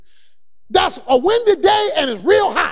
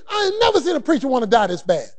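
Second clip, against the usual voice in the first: ordinary talk, nothing marked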